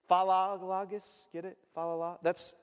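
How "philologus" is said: "Philologus" said slowly, syllable by syllable, fa-la-la-gus; careful pronunciation. Shown as a demonstration. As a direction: spell out "Philologus" slowly for the congregation. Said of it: "that's how you pronounce it".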